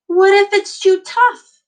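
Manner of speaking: high, loud anxious question; worried question. Acted. Teacher voicing a child's doubt, high and anxious.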